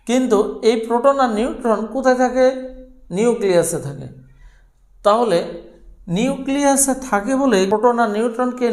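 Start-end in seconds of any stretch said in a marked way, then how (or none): none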